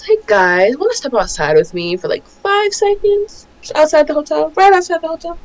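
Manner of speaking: lightly voiced high princess; sing-song princess inviting them out; cartoon princess. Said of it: "the princess says".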